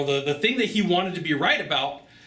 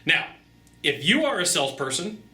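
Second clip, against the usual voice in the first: ordinary delivery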